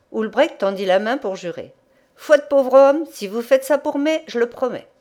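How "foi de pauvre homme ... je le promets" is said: high, loud vow that settles at the end; earnest loud oath. Acted. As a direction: swear it out proudly, hand raised. Peasant swears an oath.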